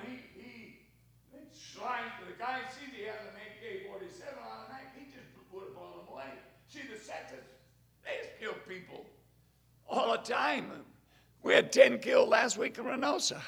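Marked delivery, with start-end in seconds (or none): none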